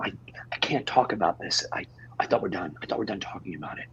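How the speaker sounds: hushed, rushed whisper; desperate whisper. Acted. Peter refuses to revisit the breakup, desperate whisper. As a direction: hide the panic in a whisper.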